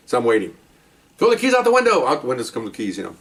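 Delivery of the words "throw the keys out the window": high barked order; shouted command. Retold or imitated. Officer commanding the suspects to toss the keys. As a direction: order them like the officer in charge. Police commands during felony stop.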